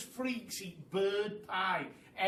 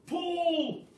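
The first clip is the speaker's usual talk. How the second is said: high, loud yell; yelled order; 12 semitones higher, 6 dB louder than usual. Acted. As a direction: yell the single word pull. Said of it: "yelled Mugglewump".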